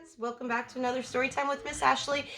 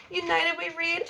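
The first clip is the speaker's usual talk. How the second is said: plain reading voice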